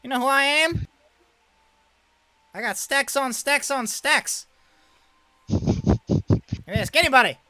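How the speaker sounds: high, loud swaggering brag; boastful showman. Acted. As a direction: puff up and boast at full volume. Boastful character voiced by DM.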